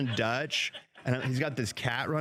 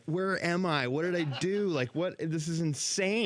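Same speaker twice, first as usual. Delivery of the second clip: bewildered panic; 6 semitones higher than usual. Retold or imitated. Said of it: "And I'm like".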